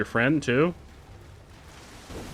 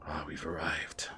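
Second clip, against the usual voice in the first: soft whisper of arrival; 10 semitones lower, 13 dB quieter than usual. Real, not acted. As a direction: whisper as you arrive somewhere grand. Hushed arrival at the Academy.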